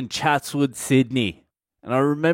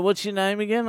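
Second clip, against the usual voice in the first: high-pitched clueless question; forgetful teacher; 7 semitones higher, 4 dB quieter than usual. Retold or imitated. Teacher forgetting his paying pupil's name, high-pitched. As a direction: ask lightly, pitched well up, as if clueless.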